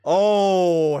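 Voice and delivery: ordinary talk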